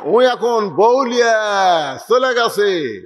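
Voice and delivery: ordinary talk, nothing marked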